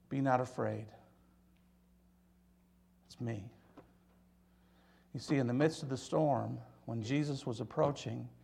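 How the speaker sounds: soft, low reassurance; hushed preaching. Acted. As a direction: drop right down and speak gently to the frightened.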